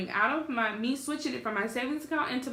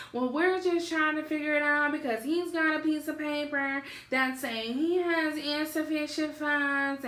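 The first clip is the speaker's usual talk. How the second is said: high, droning mock grandma; put-on whiny elder, higher than usual; annoyed grandmother imitation; 6 semitones higher than usual. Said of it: "so this is how she sounds".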